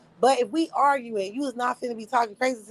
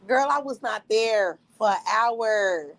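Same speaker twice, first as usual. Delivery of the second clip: ordinary delivery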